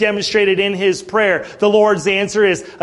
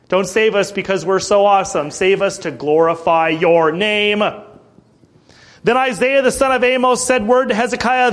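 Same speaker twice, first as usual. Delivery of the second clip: ordinary delivery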